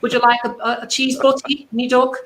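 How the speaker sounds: put-on high, sweet little old Midlands lady; high-pitched East Midlands old lady; old lady Leicester accent. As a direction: Leicester granny calling you duck. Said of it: "in a little old lady accent".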